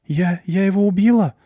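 put-on girl asking if she killed him; shaken, stammering question; trembling voice. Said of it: "дрожащим голосом спрашивает Блонда".

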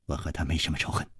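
low, soft reply; muted, low-pitched denial; subdued denial. Acted. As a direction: answer quietly and guardedly. Cornered man answers interrogator.

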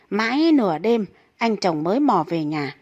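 usual speaking voice

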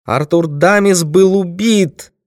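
high, slow, dramatic shout; woman's high-pitched outcry; loud cry. A narrator's reading. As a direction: cry out the revelation, stretching each word. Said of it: "громко крикнула".

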